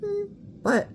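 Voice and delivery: very high soft hum; high demo hum. Shown as a demonstration. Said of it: "basically how I can do it".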